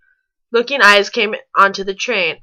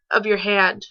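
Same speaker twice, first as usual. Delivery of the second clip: ordinary talk, nothing marked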